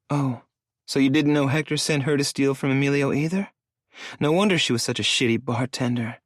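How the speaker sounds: lightly voiced bitter young woman; sarcastic woman. A narrator's reading. Layton voiced higher, sarcastic.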